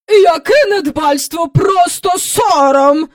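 high, drawn-out, scandalised; loud disgust. A narrator's reading. Visitor judging the hostess's garden, disgusted. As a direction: declare it with revulsion. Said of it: "з огидою мовила вона".

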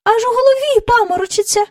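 very high chorus-of-courtiers voice; echoing courtiers. A narrator's reading. Courtiers echo the king's complaint, high-pitched parroting. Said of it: "луною підхопили всі придворні".

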